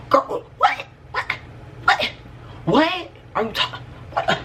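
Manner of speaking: high, gasping mock disbelief; acting shocked. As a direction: sputter it in high broken bursts, feigning shock. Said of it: "I'm acting like".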